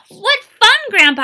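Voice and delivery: high, loud delighted cry; thrilled child thanking grandpa; excited. Acted. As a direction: burst out with joy at the balloons.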